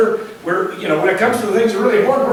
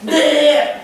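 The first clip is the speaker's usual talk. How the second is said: laughing mock bleat, pitched up; sheep bleat; 6 semitones higher than usual.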